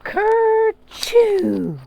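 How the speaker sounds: high, very loud kerchoo sneeze; cartoon sneeze for kids; huge fake sneeze. A narrator's reading. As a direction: blast out a big pretend sneeze. Sneeze in story.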